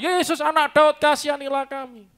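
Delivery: high, insistent cry for mercy; shouting beggar. Acted. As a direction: keep calling out, high and loud. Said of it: "Teriak terus".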